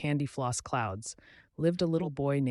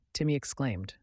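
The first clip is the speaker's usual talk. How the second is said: usual speaking voice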